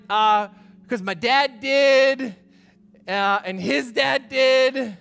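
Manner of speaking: mock dragging excuse. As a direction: drag it out like a lame excuse. Church folk answering a provocative question.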